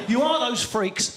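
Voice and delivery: ordinary talk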